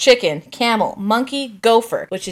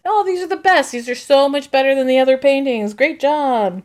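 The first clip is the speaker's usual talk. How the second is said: gushing praise; 5 semitones higher, 4 dB louder than usual. Retold or imitated. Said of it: "everyone starts chiming in".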